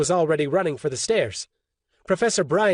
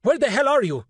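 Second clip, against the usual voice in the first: higher, frantic yell; 8 semitones higher, 3 dB louder than usual. A narrator's reading. Roberto calling for the sorcerer, frantic yell. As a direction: demand an answer in panic.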